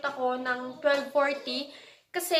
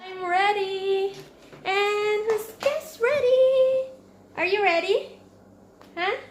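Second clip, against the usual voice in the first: high, slow, louder sing-song; 6 semitones higher, 5 dB louder, much slower than usual. Real, not acted. Excited baby talk.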